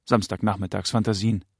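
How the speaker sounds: ordinary delivery, nothing marked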